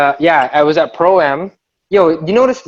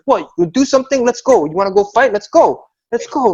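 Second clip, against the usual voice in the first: higher-pitched goading, imitating a friend; mock fight challenge; 6 semitones higher than usual. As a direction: act out squaring up for a fight.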